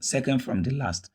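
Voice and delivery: ordinary talk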